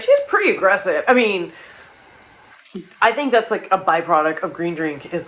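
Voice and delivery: normal talking voice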